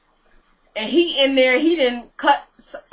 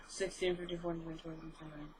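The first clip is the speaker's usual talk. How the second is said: counting years under her breath, much softer; muttered counting; 5 semitones lower, 20 dB quieter than usual. Real, not acted. Doing the math on the mother's age, muttering softly. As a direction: trail off into a hushed count.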